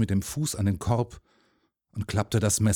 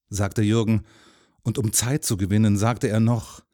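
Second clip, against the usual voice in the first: usual speaking voice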